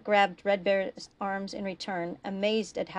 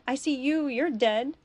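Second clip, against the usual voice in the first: raised pitch, a touch of sobbing; sobbing protest; 6 semitones higher, 4 dB louder than usual. A narrator's reading. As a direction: protest as if close to sobs. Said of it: "Buck sobbed".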